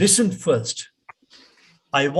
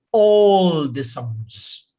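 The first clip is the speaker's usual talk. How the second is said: ALL drawn out long and loud; emphatic stretch; 5 dB louder, much slower than usual. Shown as a demonstration. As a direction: lean hard on ALL, very slowly. Teacher stretches word to illustrate.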